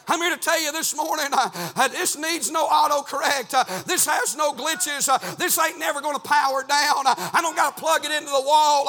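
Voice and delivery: loud, high, driving pulpit delivery; fervent preaching. Real, not acted. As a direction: belt it out in urgent rhythmic bursts. Preaches at full force.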